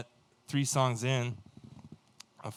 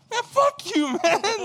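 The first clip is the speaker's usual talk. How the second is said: very high, loud comic outburst breaking into laughter; mock outraged yell; 7 dB louder, slower than usual. Son cursing out his dad at the station, mock outraged yell.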